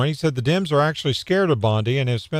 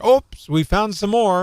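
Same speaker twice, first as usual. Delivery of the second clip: higher, slow sarcastic pretend surprise; mock innocent oops; 6 semitones higher than usual. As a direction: play at stumbling on it by accident. Mocks an imagined official announcement.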